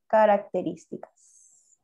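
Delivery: Características drawn out slowly, syllable by syllable; teacher spelling out Características at writing speed; slow dictation. Shown as a demonstration. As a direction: dictate Características slowly so they can write it.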